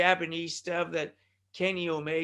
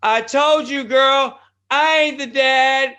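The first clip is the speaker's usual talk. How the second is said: higher, louder, slow gloating boast; gloating dad voice; 6 semitones higher, 10 dB louder, slower than usual. Retold or imitated. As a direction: rub it in like a proud dad. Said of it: "he says".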